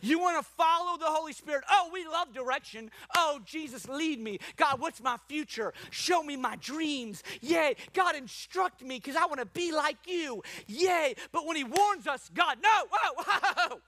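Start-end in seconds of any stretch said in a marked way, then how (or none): 1.7-11.2 high-pitched gushing enthusiasm; mock eagerness. Mimics eager believers asking for guidance. 12.3-13.8 high, loud recoiling protest; mock alarm. Acts out recoiling from a warning.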